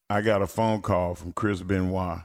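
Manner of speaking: ordinary delivery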